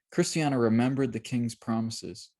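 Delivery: plain reading voice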